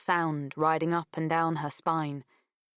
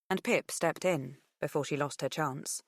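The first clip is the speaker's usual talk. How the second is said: usual speaking voice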